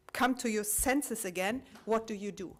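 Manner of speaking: normal talking voice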